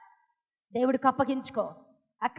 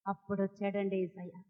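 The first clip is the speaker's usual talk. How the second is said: low, very soft reveal; hushed; 6 semitones lower, 11 dB quieter than usual. Real, not acted. Preacher reveals to congregation that Jesus then arrived, hushed. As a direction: drop right down, almost under the breath.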